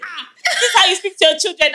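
high, amused retold astonishment; laughing mock surprise. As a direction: laugh through a mock discovery.

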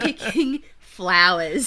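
loud, slow, exaggerated Aussie accent. Answering the Irish version mockingly, broad Aussie accent. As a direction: drag out the Australian vowels in flowers. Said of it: "then an Aussie accent".

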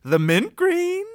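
high, slow, deliberate list; showy eager recital. Acted. Son orders paints he picked a month ago, showily. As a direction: draw out each color, rising proudly.

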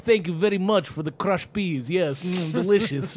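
hammed-up Russian accent. Parents voicing their little girl's thoughts, Russian accent. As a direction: relish it in a mock Russian accent. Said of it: "talk to her as she's a Russian".

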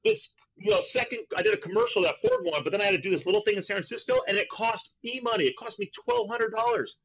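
very high, fast naive babble; clueless young self. Retold or imitated. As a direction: play the oblivious kid, voice up high. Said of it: "And I'm like".

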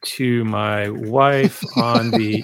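words stretched out slowly as if typing; very slow spoken typing; slow dictation. Shown as a demonstration. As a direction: dictate it word by word to the keyboard. Typing prompt aloud while dictating.

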